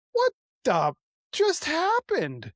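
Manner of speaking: very high, slow, baffled question; stunned disbelief. Retold or imitated. Speaker baffled by sudden weeping after fight, stunned disbelief. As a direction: draw it out in high-pitched bewilderment.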